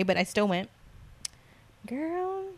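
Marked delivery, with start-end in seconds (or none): 1.9-2.4 high exclamation. Real, not acted. Exclaiming to the listener.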